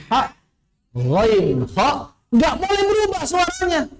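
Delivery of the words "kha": ordinary delivery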